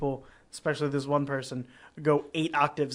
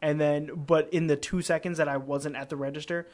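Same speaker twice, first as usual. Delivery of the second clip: normal talking voice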